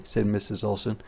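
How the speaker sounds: plain reading voice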